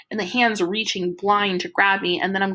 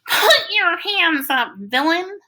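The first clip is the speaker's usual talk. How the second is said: high, thin voice calling from a distance; a tiny hero's challenge, pitched high; small faraway voice; 11 semitones higher, 4 dB louder, slower than usual. A narrator's reading. Freak calls down from the window in a small faraway voice. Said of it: "small, faraway voice is saying".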